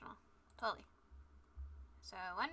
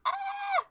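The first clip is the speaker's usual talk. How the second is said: high, loud shriek of alarm; a squeal of real panic; panicked shriek; 8 dB louder than usual. Real, not acted. Panic over missing link.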